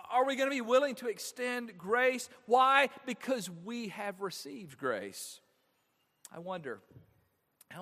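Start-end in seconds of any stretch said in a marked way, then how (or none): 0.1-5.4 high, loud questions, then a slow answer; rising preaching. Acted. Pastor challenging the congregation, forceful preaching. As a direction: drive the challenge home to the church.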